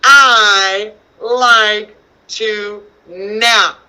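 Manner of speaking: very slow, loudly launched word-by-word reading; read-along pace. Shown as a demonstration. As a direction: lead children through it, word by word. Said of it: "read this one with me".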